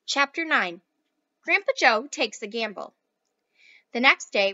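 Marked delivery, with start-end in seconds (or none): none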